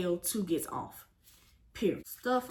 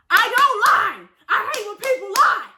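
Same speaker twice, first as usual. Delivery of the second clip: sassy high voice; 10 semitones higher, 11 dB louder than usual. Pretend. High put-on declaration.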